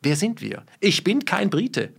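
ordinary delivery

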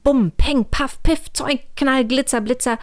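high, punchy sound-effect words; magic bangs. A narrator's reading. The spell undoes the colours, high excited bursts. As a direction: pop each word out excitedly.

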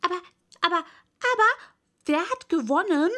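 high, loud clamour of questioners; breathless question. A narrator's reading. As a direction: press in with an eager, high-pitched question. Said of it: "außer Atem mit der Frage".

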